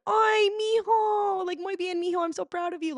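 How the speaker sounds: high, slow doting mom voice with Spanish; Mexican mom. Retold or imitated. Comic acting out her improvised bit for the crowd, doting mom voice. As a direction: coo like a proud Mexican mother. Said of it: "but I just went".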